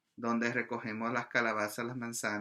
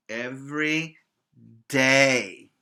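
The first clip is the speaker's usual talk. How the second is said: "day" punched much louder; stress on every day; 8 dB louder, slower than usual. Shown as a demonstration. Teacher insisting pupils practise the alphabet daily, emphatic. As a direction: hit "Every day" hard, the second word loudest. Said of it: "repeating for emphasis".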